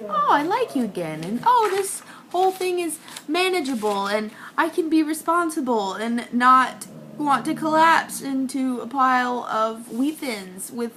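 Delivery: high, sweet delight; acted happy relief. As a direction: gush with newfound affection. Acts out the happy normal mood.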